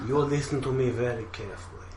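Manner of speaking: put-on low, soft villain line in a Russian accent; menacing hint of a Russian accent. Villain threatens the cops, soft Russian accent. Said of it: "I can't do accents".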